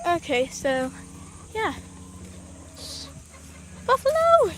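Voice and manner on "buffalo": high loud call of the name; high sudden call. Real, not acted. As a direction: shout her name in surprise. Calling out to hen.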